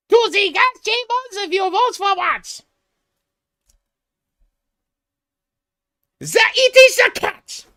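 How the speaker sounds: hammed-up screaming rant, very high and loud; mock tantrum at the podium, strained high yelling; J D Vance. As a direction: shriek it like an unhinged debater. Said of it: "If I was J D Vance".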